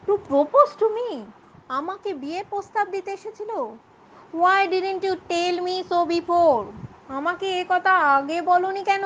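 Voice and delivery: high, slow, stunned disbelief; shocked. Acted. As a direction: gasp it out as news lands.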